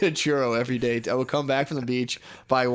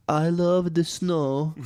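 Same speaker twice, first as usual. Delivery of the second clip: mock Brazilian girl; 4 semitones higher, much slower than usual. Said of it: "she's like".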